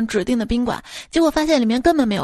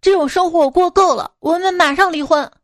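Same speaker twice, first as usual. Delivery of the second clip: fed-up husband shouting, high and loud; acted furious outburst; angry roar; 7 semitones higher, 4 dB louder than usual. A narrator's reading. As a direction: roar at your wife, pitch raised. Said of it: "冲着妻子大吼".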